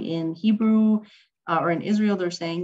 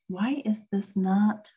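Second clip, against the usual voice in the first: normal talking voice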